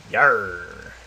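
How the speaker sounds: loud hammy pirate growl. Acted. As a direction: play it like a pirate captain. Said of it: "started talking like a pirate".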